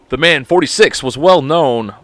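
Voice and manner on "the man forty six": ordinary talk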